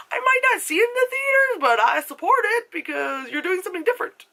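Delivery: high, hammed-up encouragement; squeaky supportive aside; high-pitched support. Acting out own supportive reaction.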